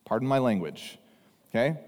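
normal talking voice

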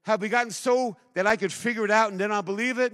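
loud, high-pitched full-force preaching; fervent preaching. Acted. Pastor urging his church to believe, full-force preaching. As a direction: pour it out with fire to the congregation. Opening fervent sermon appeal.

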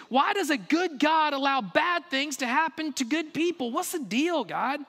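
high-pitched, impassioned questioning of God; pleading protest. Acted. Believer demands answers from God, pleading protest. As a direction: cry out, pressing question after question.

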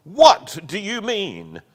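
high, loud, slow outraged demand; screaming. A narrator's reading. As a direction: fling the question back at her, furious. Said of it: "screamed the tragedian".

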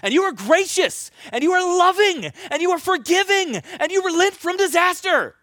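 high, mock-outraged accusation; acted accusing tirade. Angry shopper accuses someone of being kind, acted tirade. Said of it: "they start accusing you and saying".

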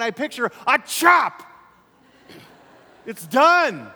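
loud, high-pitched pulpit shout; full-force preaching. Real, not acted. Preacher telling parents they gave her away, forceful. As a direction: thunder it out to the congregation.

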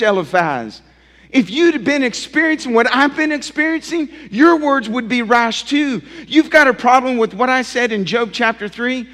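loud, high, heated protest to his friend; Job protesting. Acted. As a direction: rail back at Eliphaz, hurt and defiant. Preacher voices Job's retort.

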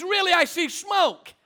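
high-pitched, insistent imitation of alarm; imitated insistence. Wife insists to sleepy husband about smoke, imitated alarm. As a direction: play her insisting, voice pitched right up. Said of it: "she screams out".

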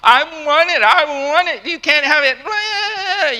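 put-on whiny child, pitch jumping up, dragged slow at the end; mock a kid throwing a fit; temper tantrum. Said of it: "It's not a temper tantrum".

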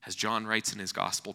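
normal talking voice

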